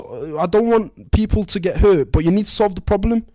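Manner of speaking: high, loud, upset put-on Scouse voice; distraught wife. Wife begs husband for help, high distraught voice. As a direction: play the wounded wife, pitched high and loud.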